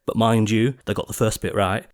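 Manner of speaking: plain reading voice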